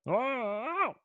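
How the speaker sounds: high yelp as the dog; a dog's startled cry; cartoon dog. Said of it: "give the voice of the dog".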